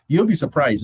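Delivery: usual speaking voice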